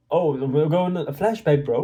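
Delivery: normal talking voice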